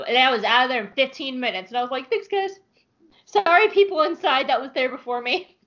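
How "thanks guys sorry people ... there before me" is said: high, sheepish thanks and apology; a lightly voiced sheepish customer. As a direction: thank them brightly, then apologize.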